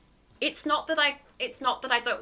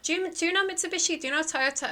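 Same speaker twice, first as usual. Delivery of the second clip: mock non-stop info-dumper; imitating someone who won't let others answer; eager imitation; faster than usual. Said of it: "before I can finish answering, he's like".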